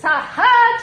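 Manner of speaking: high, loud taunting ha ha; gloating laugh. Acted. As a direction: crow over a rival. Gloating at man on TV.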